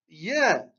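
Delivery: "ya" with the "a" held long; drawn-out long vowel. Shown as a demonstration. Teacher ending the letter list, "ya" held long. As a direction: stretch out "ya". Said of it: "Donc on allonge".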